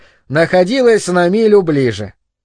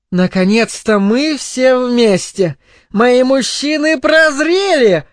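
high, slow tipsy woman making a toast; proclaiming a toast; 8 semitones higher, slower than usual. A narrator's reading. Tipsy mother toasts her husband and son, proclaiming. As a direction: draw it out grandly, pitched well up. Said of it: "словно произнося тост".

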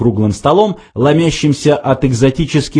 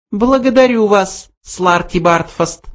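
high, curt mouse voice; cold cut-off; 9 semitones higher than usual. A narrator's reading. Benjy coldly dismisses Slartibartfast, curt high voice. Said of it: "холодно отрезал Бенджи".

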